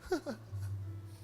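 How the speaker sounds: soft, high mock snicker; quiet breathy fake laugh; imitated onlookers' laughter. Bystanders laugh at praying man, mock snicker. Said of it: "As pessoas começaram a rir".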